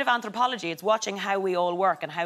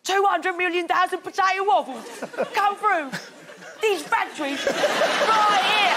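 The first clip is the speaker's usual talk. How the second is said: high, loud mock voiceover; viral voiceover imitation; 11 semitones higher, 3 dB louder than usual. Comedian imitating a viral factory clip, high loud mock voiceover. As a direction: belt it out high, dragging the ending.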